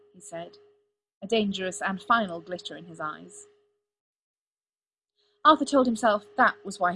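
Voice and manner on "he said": ordinary delivery, nothing marked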